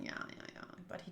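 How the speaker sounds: breathy whispered yeahs; whispered aside. Real, not acted. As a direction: mutter it under your breath. Muttered aside, unvoiced.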